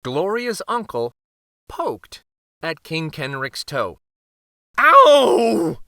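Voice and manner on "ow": high, loud yelp of pain. A narrator's reading. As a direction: yelp at the poke. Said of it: "the king yelled".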